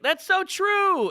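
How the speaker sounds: mock a clueless fan agreeing, slowly; ditzy blonde heckler. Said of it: "she was like".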